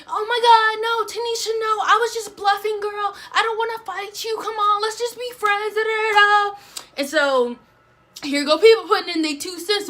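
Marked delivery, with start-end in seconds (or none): 0.1-6.6 high, panicky begging backdown; mock scared pleading. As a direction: squeal it out like a scared bluffer. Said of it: "Deja turned into a punk".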